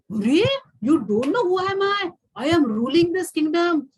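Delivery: high, loud, outraged royal voice; a proud king insulted that he is not known; affronted ruler demanding recognition; indignant king. Acted. King boasting to the sadhu.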